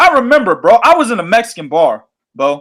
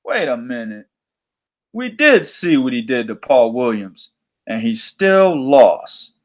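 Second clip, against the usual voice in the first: scheming promoter; 8 semitones lower than usual. Retold or imitated. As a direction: mull it over like a sly promoter plotting. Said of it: "and then he goes".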